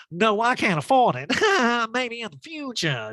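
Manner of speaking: high, giggly mock laughing voice; hammed-up laughy brush-off; Erica. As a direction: giggle through it in a high impression. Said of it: "laughy, giggly Erica".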